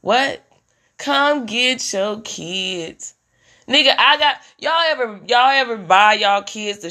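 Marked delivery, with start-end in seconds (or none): 1.0-3.1 slow pleading call. Retold or imitated. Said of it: "I'm calling daddies".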